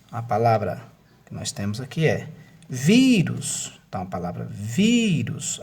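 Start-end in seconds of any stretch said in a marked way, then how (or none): 2.7-3.8 "vírus" with VI high and drawn out; VIIIrus, emphatic first syllable; stress on VI. Shown as a demonstration. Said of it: "a sílaba tônica é no vi". 4.4-5.6 "vírus" with VI stretched and rising; VIIrus, first syllable swelling; stress on VI. Shown as a demonstration. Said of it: "a sílaba tônica é no vi".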